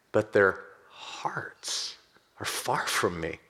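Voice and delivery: breathy, slow whisper of the verse; whispered scripture. Acted. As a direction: hush it as if sharing a grief. Quoting Isaiah, hushed.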